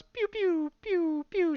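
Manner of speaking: high mock laser "pew" noises; zap sound effect. Telling the mind-reading scene with a high mock zap noise. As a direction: zap it out like a cartoon blaster.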